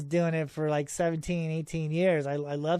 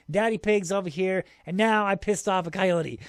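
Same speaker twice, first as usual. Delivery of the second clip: ordinary talk, nothing marked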